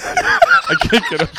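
laughing. Real, not acted. Laughing at friend's voice.

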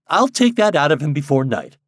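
lively, light-hearted bravado; merry. A narrator's reading. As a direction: boast gaily about winning someone over. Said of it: "cried Helen Wingate merrily".

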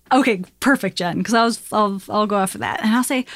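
normal talking voice

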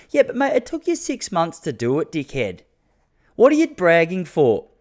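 high-pitched scornful comeback; mock retort. Podcaster imagines snapping back at the builder, scornful and high. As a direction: cut the builder down, sneering.

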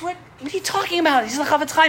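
can't believe what he's hearing; mild mock outrage; incredulous protest. Fellow protests in disbelief.

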